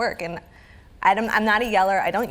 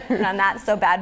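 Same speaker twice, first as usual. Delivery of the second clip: usual speaking voice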